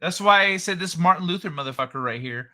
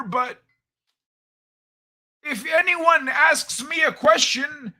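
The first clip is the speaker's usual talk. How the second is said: put-on high, loud old-man character voice; Old Man Kai voice; 9 semitones higher, 5 dB louder than usual. Host ribbing his co-host, as a high, loud old man. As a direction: play the cranky old man, pitched up.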